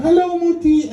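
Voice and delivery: put-on high singsong little-girl hello; daughter's greeting. Daughter coming home to her mother, sweet singsong. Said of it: "she comes and she's like".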